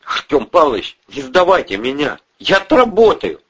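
high-pitched begging, rising to a cry; desperate pleading. Acted. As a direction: beg the boss for mercy. Young man begs not to be handed over.

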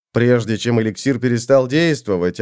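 plain reading voice

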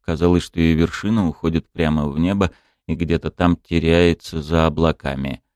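plain reading voice

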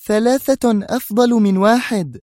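very high, insistent woman's voice; defiant high-pitched retort; stubborn exclamation. A narrator's reading. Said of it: "هتفت في عناد".

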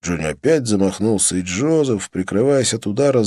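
plain reading voice

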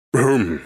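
short embarrassed "ahem"; throat-clearing cough. A narrator's reading. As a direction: cough into your fist. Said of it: "кашлянул в кулак Альберт". (смущённое покашливание)

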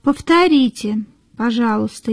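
normal talking voice

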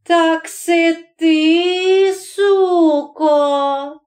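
high, slow accusation; acted venomous outrage; confronting rival. A narrator's reading. Deacon's wife confronts her rival.

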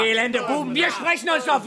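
drohend. Acted. Rath droht den Schülern.

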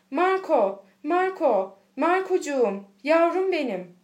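high, loud calls to a long-lost son; joyful cry. A narrator's reading. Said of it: "hemen bir çığlık attı". (sevinç çığlığıyla seslenerek)

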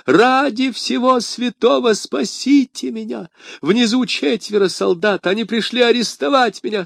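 a terrified shopkeeper wailing for help; высоким голосом, с мольбой; wailing plea. A narrator's reading. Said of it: "возопил он".

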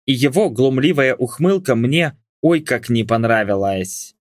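ordinary delivery, nothing marked

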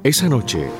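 plain reading voice

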